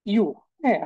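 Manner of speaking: ordinary delivery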